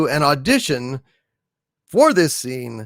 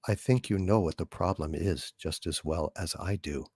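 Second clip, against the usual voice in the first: much lower and much softer, calm and even; HAL computer voice; 11 semitones lower, 13 dB quieter than usual. Acted. The ship computer answering astronaut Dave, low, calm and soft. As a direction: speak low, soft and unhurried like a polite machine. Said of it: "from two thousand and one, A Space Odyssey".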